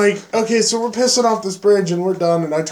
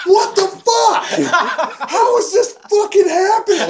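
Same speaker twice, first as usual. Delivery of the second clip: much higher, laughing through a mock angry outburst; laughing mock outrage; 13 semitones higher than usual. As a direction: play it pissed off but cracking up.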